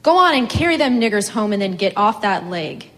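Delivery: snatch the hose and bark it at him; angry command. A narrator's reading. Woman orders the farmhand angrily.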